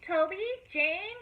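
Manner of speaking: high drawn-out call. A narrator's reading. Mama Sue calling Toby and Jane, slow high call. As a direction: call slowly and high to the children. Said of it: "called Mama Sue".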